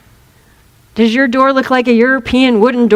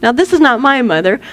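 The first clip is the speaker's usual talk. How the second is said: ordinary talk, nothing marked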